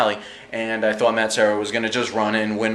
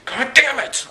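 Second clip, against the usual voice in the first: frustrated outburst; 13 semitones higher, slower than usual. Retold or imitated. Frustrated at the knockout.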